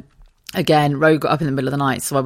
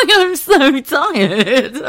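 high, slow laughing sigh; laughing tiredly; 9 semitones higher, 7 dB louder, much slower than usual. Real, not acted. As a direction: giggle out the exhaustion. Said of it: "let me just get my fucking words right".

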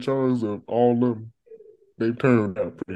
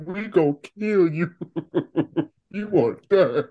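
laughing; 7 semitones higher than usual. Retold or imitated. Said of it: "'cause it's just like".

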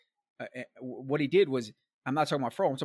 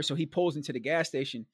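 usual speaking voice

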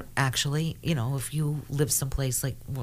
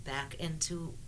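guided meditation; 11 dB quieter than usual. Acted. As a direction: quietly lead them through the journey. Guides the listeners' spirit journey.

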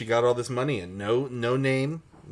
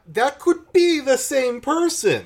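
high, loud squeal of realisation; hammed-up eureka; mock excited deduction; 8 dB louder than usual. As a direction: blurt it out far higher and louder. Mock sudden deduction about two descriptions.

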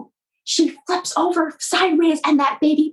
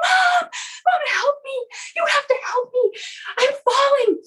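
mock screaming; 10 semitones higher than usual. Falling baby bird crying to Mom, mock screaming. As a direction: call out high like a frightened little bird. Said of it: "starts screaming, and he's saying".

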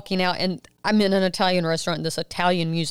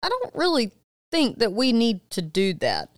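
hesitant objection; 6 semitones higher than usual. Retold or imitated. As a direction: stall high, then object carefully. Retold wary objection on phone.

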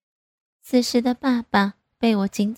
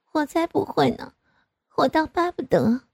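higher-pitched pouty retort; scoffing daughter; 7 semitones higher than usual. A narrator's reading. Daughter dismissing her father's doubt, scoffing. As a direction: toss it back in a higher voice.

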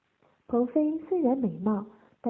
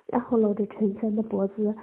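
ordinary delivery

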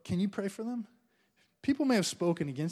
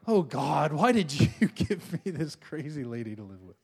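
whiny husband complaining about his wife, low; mock-grumbling husband; 4 semitones lower than usual. Husband grumbling to God about his wife, mock-exasperated. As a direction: groan it out like a fed-up husband.

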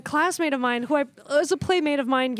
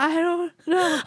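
slow, wobbly whimper through laughter; mock crying child; 2 semitones higher, slower than usual. Child asked what they have there, mock crying. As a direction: imitate a guilty kid starting to cry. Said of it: "And I started crying".

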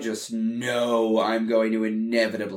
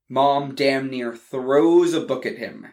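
ordinary delivery, nothing marked

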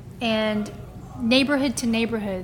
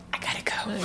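whispered escape line; hushed, urgent I gotta go; 9 dB quieter than usual. Retold or imitated. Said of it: "I'd be like".

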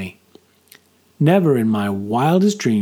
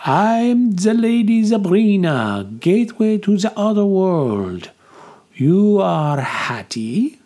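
grand fortune teller; 8 semitones higher, slower than usual. A narrator's reading. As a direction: proclaim like a seer from beyond. Fortune teller introduces herself.

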